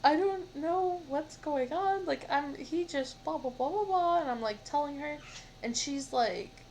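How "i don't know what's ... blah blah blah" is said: upset rant to mom. Retold or imitated. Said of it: "And I was like".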